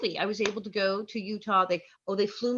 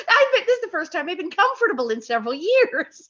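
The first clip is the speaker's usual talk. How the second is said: higher and louder, hamming up her joy; mock delighted relief; 9 semitones higher, 8 dB louder than usual. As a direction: play her younger self, thrilled to finally be comfortable. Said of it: "I said".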